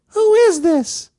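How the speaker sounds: very high, slow and loud mock woman's voice; imitating the woman on the phone, high and loud; shrill lady. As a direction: squeal it like a startled old lady. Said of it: "And she's like".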